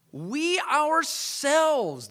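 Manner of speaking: WE OURSELVES drawn out very slowly, high and forceful; emphatic. Acted. Preacher voicing the crowd's reply to Jesus, emphatic. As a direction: stretch WE OURSELVES long and high, every word weighted. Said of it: "is emphatic in the Greek here".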